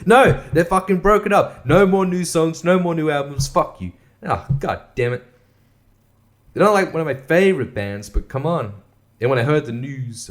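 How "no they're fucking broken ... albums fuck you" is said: loud, high indignant rant; exasperated outburst. Real, not acted. Fan venting about Daft Punk breaking up, exasperated. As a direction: burst out, hammering each "no".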